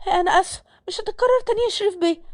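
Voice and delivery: high-pitched maid pleading for pardon; meek scared apology. Acted. Scared maid apologises to angry employer. (اعتذار بخوف بصوت عالي النبرة)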